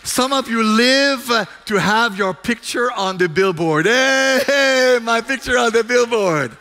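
loud, high sermon delivery, driving each question home; fervent preaching. Acted. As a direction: preach it at full force to the congregation.

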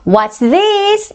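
high-pitched teacher prompt; big-voiced drill model. Shown as a demonstration. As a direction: project the question high for the class to copy. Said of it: "큰 소리를 하셔야 돼요".

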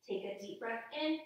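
ordinary talk, nothing marked

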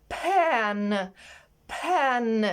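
"пен" stretched long on the vowel and n; word modelling. Shown as a demonstration. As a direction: draw out "пен" for pupils to copy. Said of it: "Повторіть за вчителем". (протяжна вимова «пен»)

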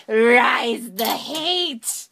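slow, loud villain roar; enraged boss roar. Acted. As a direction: drag out each word in rage. Villain command.

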